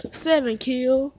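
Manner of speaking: ordinary talk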